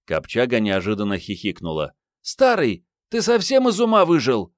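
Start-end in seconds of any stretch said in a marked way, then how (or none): 2.2-4.5 put-on very high, loud, incredulous girl's voice; teasing young woman. A narrator's reading. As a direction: scold the old man, high and loud. (высокий громкий девичий голос, с издёвкой)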